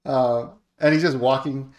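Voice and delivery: usual speaking voice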